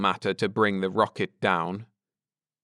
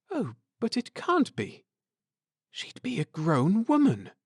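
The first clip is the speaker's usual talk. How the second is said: much higher and softer, hushed disbelief; stunned whisper; 9 semitones higher, 4 dB quieter than usual. A narrator's reading. Wife stunned to see the unchanged child, hushed. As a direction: breathe it out in shock. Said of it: "in a stunned whisper".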